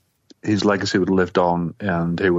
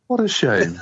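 sarcastic; 8 semitones higher, slower than usual. Retold or imitated. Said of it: "And I thought".

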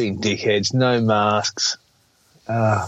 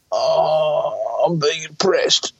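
put-on high, slow whiny teenager voice; mock teen whine; 4 semitones higher, much slower than usual. Dad mimicking his eldest son's complaint, mock whining. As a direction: play the hard-done-by teen, whining. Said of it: "he used to, oh, do anything".

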